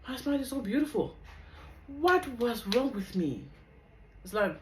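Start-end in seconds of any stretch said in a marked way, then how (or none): 0.1-3.4 high, loud astonished exclaim; delighted disbelief at an old photo; amazed at herself. Retold or imitated. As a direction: gasp at your own smile, disbelieving. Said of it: "I'm like".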